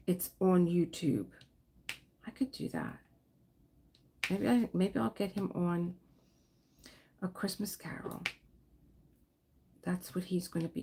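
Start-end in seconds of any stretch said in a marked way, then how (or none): none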